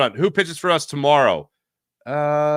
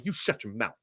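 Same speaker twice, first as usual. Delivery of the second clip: muttered comeback; 6 semitones lower, 13 dB quieter than usual. Pretend. Scripted comeback to the killjoy.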